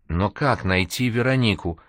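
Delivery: ordinary delivery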